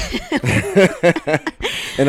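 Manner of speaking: high, loud burst of laughter. Real, not acted. High laughing after the joke.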